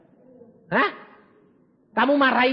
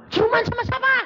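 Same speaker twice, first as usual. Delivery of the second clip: high, startled asking; shocked question; 4 dB louder than usual. Retold or imitated. Pastor hearing shocking news, high startled question. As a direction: gasp out the question in disbelief.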